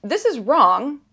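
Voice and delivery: firm healthy anger. Shown as a demonstration. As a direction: plant your feet and say it firmly. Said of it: "there's also healthy anger where it's like".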